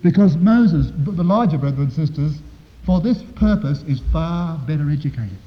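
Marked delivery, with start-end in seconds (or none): none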